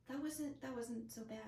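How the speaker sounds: soft, small voice, as if to oneself; hushed retold self-talk; relieved realization. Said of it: "it was just like".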